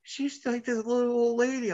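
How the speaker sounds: high mock voice of a shocked crowd; imitating the crowd. Host mimics awards crowd calling Betty harmless, high mock voice. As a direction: raise the voice way up, sweetly mocking.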